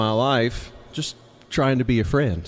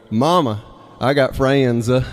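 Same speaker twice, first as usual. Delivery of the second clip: put-on little kid's Southern drawl, FRIENDS stretched into "fray-en-duh"; slower than usual. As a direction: imitate a Southern child, FRIENDS in three syllables. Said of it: "saying friends with three syllables".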